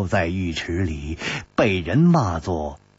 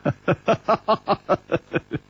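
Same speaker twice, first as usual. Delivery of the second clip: easy, amused little laugh; light chuckle; 8 semitones higher than usual. Acted. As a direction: chuckle at her. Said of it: "才微笑着说".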